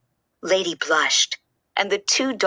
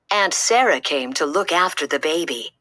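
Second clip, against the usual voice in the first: ordinary delivery, nothing marked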